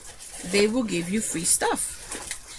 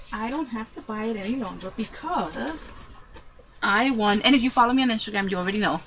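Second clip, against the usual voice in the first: ordinary delivery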